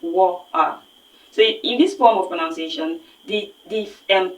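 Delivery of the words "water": "water" with a glottal stop, no T; London glottal water. Shown as a demonstration. Teacher repeating the London water for students. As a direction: drop the T: "wa'er". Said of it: "these people pronounce water as".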